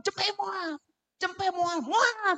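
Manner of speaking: high-pitched mock threat; insistent, high mock scolding; acted scolding. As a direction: play a parent ordering a child to hush. Parent hushing a crying child.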